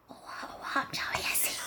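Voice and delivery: whispered Essex catchphrase; Joey Essex. Acted. Testing whether Essex can be whispered, hushed Essex accent. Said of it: "I can't whisper in an Essex accent".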